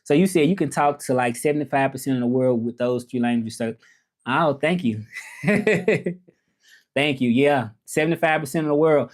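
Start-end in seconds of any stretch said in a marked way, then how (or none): 5.4-6.1 short pleased chuckle, higher pitched; quick giggle after saying thanks; light, high little laugh. Real, not acted.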